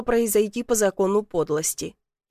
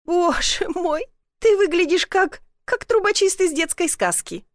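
high-pitched, tickled exclamation; amused, laughing; 8 semitones higher than usual. A narrator's reading. Woman seeing soot-covered man, amused. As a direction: gasp out mirth at the sight. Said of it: "снова рассмеялась".

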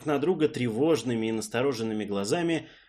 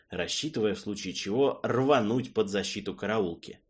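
ordinary delivery, nothing marked

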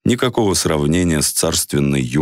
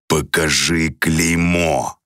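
slow stony repeated command; menacing savage, slow and grinding; grating voice; much slower than usual. A narrator's reading. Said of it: "повторил он".

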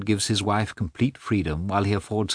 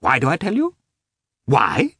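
higher, sharp angry retort; 11 semitones higher, 4 dB louder than usual. A narrator's reading. Husband snapping back at his wife angrily. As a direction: fling the question back at her. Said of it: "just as angrily".

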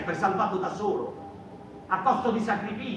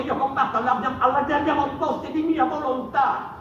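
defiant declaration; 5 semitones higher, 6 dB louder than usual. Acted.

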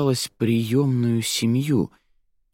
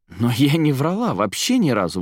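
indignant protest, pitched up; higher-voiced, affronted denial; 6 semitones higher, 3 dB louder than usual. A narrator's reading. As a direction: protest your innocence with mild outrage. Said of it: "возмутилась девушка".

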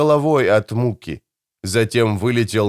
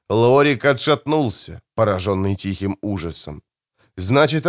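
ordinary delivery, nothing marked

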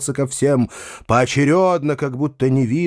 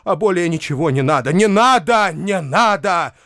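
higher-pitched theatrical pleading; melodramatic cry; 6 semitones higher than usual. A narrator's reading. Foma begs for no monument, crying out. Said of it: "кричал Фома".

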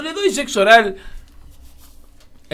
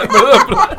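laughing; 4 dB louder than usual. Real, not acted. Caught misreading, laughs.

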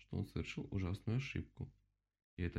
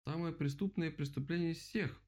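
outraged; 9 semitones higher than usual. A narrator's reading. Animals outraged at new charge.

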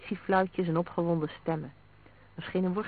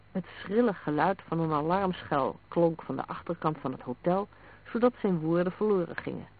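plain reading voice

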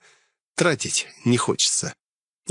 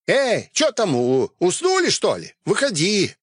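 calling out; 8 semitones higher than usual. Acted. Bandit calls cultists out.

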